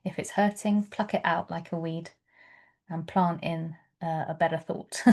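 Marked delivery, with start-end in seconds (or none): none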